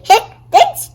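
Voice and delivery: high hiccup then thanks. Acted. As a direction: hiccup before a thank-you.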